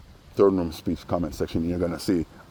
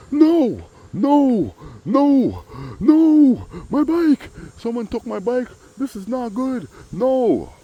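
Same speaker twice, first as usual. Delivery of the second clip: much higher, louder put-on wail of dismay; distressed neighbor; 5 dB louder, much slower than usual. Davo finding his bike stolen, high loud wailing dismay. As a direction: howl it like your bike was just stolen. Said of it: "here he comes downstairs".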